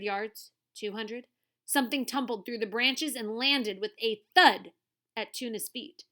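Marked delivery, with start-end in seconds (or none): none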